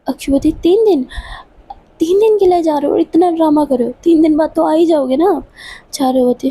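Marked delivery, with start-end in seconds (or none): 0.5-5.5 high-pitched, scoffing disbelief; narrated incredulous questioning. A narrator's reading. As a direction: question the fuss in a raised pitch. Disbelief at drama over three days.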